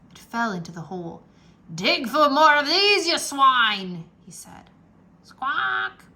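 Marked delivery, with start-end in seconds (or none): 1.7-4.0 loud gruff command; captain orders pirates to dig. A narrator's reading. 5.2-6.0 high squawking parrot cry. A narrator's reading. Parrot sound in story.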